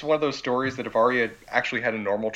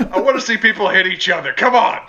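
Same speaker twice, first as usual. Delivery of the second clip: much higher, louder, laughing bellow; drunk king shout; 9 semitones higher, 11 dB louder than usual. Retold or imitated. The drunk king hollers at the jousters, high and laughing. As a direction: holler it like a bored king wanting blood.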